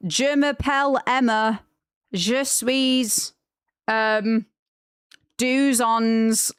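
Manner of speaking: put-on schoolkid French in a thick Greater Manchester accent; mock a Manchester pupil reading French; bad Manchester French. Mocking classmates reading French in Greater Manchester accent. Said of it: "a really bad Greater Manchester accent".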